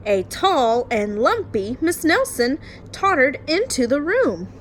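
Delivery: lively storytime read-aloud; story narration. Acted. Teacher reading a bedtime picture book to her class, lively storytime voice.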